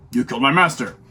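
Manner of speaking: put-on old kung fu movie dub voice; badly dubbed kung fu. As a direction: deliver it like a clunky English dub. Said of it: "the badly dubbed voice".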